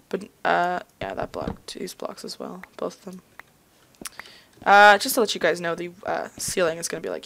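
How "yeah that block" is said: ordinary talk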